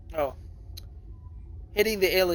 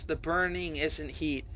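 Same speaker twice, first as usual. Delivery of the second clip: ordinary talk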